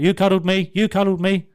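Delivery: high, loud accusation; having a go. Retold or imitated. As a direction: imitate an aggrieved man having a go. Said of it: "started having a go at me".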